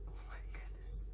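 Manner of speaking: hushed gasp of delight; whisper. Real, not acted. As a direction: breathe it out in awe. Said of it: "whispered aside".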